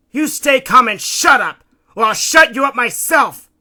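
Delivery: high, very loud angry bark; barked threat. A narrator's reading. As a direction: bark it to subdue him. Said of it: "Bugsy barked".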